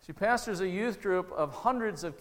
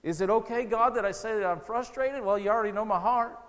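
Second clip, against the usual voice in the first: raised, louder, pleading prayer; honest prayer; 4 semitones higher, 5 dB louder than usual. Acted. Preacher acting out his own honest prayer on his knees, raw pleading. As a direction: pour it out to God, raw and frustrated.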